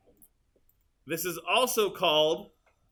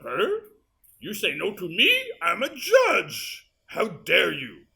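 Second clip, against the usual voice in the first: hammed-up outrage, higher and louder, stretched out; shocked judge; 4 semitones higher, 4 dB louder, slower than usual. Editor voices the judge's shocked face, mock outrage. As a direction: play an affronted judge, slowing for emphasis. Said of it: "looks shocked ... looks up and goes".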